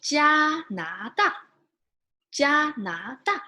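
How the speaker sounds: Canada said as jia-na-da, slow, jia high and level; Mandarin Jianada. Shown as a demonstration. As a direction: model Canada as jia-na-da for students to repeat. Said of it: "How do you say Canada again?".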